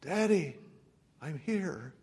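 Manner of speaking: put-on faint, soft child's reply; weak voice. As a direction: answer weakly from under rubble. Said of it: "a weak voice could be heard".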